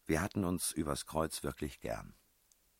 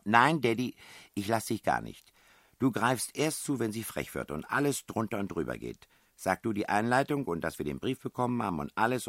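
higher, louder voice giving orders; bossy princess; 7 semitones higher, 6 dB louder than usual. A narrator's reading. Princess instructing her partner before confrontation, bossy. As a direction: take charge, pitched up and emphatic.

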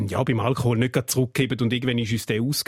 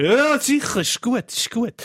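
tipsy agreeing; 8 semitones higher than usual. Retold or imitated. Retold drunk agreeing to police.

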